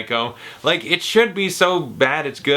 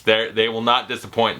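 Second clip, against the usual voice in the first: normal talking voice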